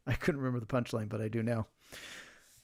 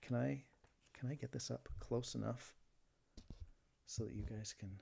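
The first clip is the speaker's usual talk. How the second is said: ordinary talk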